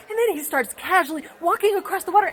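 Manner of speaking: ordinary talk, nothing marked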